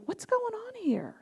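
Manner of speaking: soft, high-pitched wondering; hushed, lilting self-question; stepping back. Acted. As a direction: ask yourself gently and curiously.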